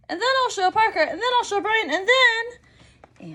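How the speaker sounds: put-on high, loud, breathless rush of a thrilled child; bubbling over to show everyone; excited little girl. Child telling daddy her plans, high and excited.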